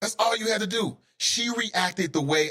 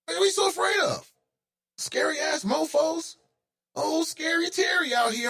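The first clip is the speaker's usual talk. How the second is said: mocking fear; 12 semitones higher than usual. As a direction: tease them like scaredy-cats. Mocking scared officers.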